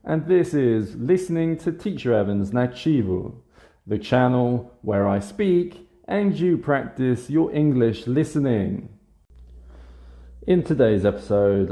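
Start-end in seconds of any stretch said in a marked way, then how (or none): 0.0-8.9 upbeat channel intro. Real, not acted. Teacher welcoming listeners to the channel, upbeat intro voice. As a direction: open the show with bouncy, raised pitch.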